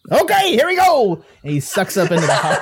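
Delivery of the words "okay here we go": high, lilting ghost voice; eager ghost Willie. Acted. Ghost about to go into the heart, eager. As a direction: launch off excitedly in character.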